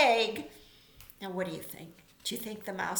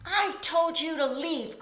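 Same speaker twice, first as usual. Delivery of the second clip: a raised, cross bear's cry; cried out; 7 semitones higher, 3 dB louder than usual. A narrator's reading. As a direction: tell the mouse off, a little higher and louder. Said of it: "cried Bear".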